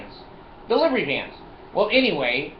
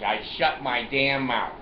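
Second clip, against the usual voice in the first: ordinary talk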